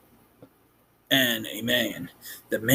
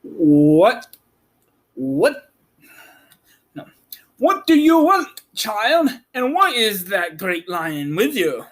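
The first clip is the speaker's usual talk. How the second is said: high, wary farm woman; 7 semitones higher, 4 dB louder than usual. Acted. Woman at door to strange visitors, wary and high. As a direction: voice the suspicious woman higher.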